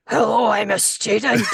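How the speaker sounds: wrecked-voice student, loud hammed-up impression; a student voice gone comically wrong, projected; Bea Arthur. Recalling a failed student recording with a wrecked voice, hammed-up impression. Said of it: "Bea Arthur, just going".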